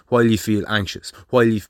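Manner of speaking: usual speaking voice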